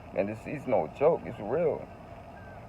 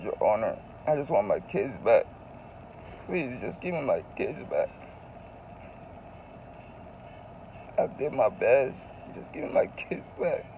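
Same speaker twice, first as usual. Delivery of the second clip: tearful plea; 6 dB quieter than usual. Retold or imitated. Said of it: "And I started crying".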